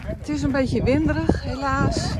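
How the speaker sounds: windy outdoor recording; wind on mic. Real, not acted. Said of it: "Sorry voor de wind". (wind op de microfoon)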